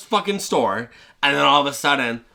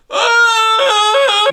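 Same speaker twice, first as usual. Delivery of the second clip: very high, loud yelp; hammed-up yelp of pain; cartoon pain shriek; pain scream; 8 dB louder than usual. Burglar burned by the doorknob.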